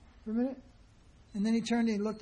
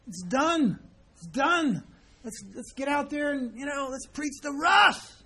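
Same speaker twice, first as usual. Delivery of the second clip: louder, hammed-up eager shouting; mock eager youngster; 4 semitones higher, 5 dB louder, slower than usual. Mocking a young boy who thinks the work is done, with eager shouts. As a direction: mimic a brash boy rushing off to preach. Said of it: "like one young boy told us".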